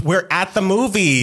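high mock telling-off; mock scolding. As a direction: scold noisy moviegoers, playing it up. Retold scolding of noisy moviegoers.

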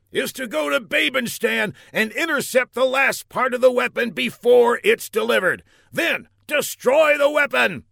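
high, loud commanding general; shouting general. Acted. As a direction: bark out the orders. General gives mission.

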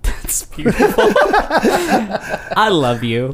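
high, slow laughing I love you. Real, not acted. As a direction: laugh through the words. Laughing affection.

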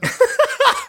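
very high burst of laughter; shrieking laugh. Real, not acted. Player reacting to the flaw, a high shrieking laugh. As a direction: squeal of laughter. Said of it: "laughs at the roll".

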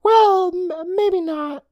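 put-on high boyish voice, hesitant and uneasy. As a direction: back down awkwardly in a kid voice. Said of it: "Day Day said uneasily".

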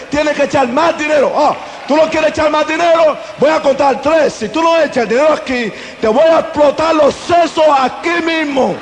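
bully them into paying up; retold gang shouting. Gang forcing the offering.

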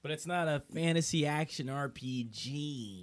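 pedantic nerd correcting a friend; nitpicky know-it-all voice; geek voice. Acted. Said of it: "I was about to do my geek voice".

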